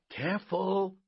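high, tense word of warning; high-pitched cautioning call; urgent warning. A narrator's reading. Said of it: "Robert Arthur warned".

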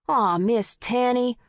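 put-on high, drawn-out boyish whine; small boy's voice. A narrator's reading. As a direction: protest like a scolded little boy. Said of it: "A small voice came from the roof".

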